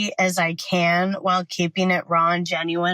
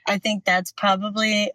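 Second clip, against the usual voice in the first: normal talking voice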